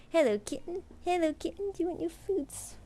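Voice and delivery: high, sing-song pet voice. Real, not acted. Owner cooing at her kitten in high baby talk.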